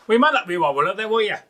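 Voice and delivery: high, loud mock Scottish accent. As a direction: do a broad Scottish voice. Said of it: "that old Scottish phrase".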